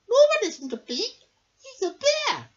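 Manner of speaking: high, fast, pert little bee; clever little bee. Acted. Amelia the clever bee quizzes Norman, high and quick. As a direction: question him like a sharp little know-all. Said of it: "said Amelia".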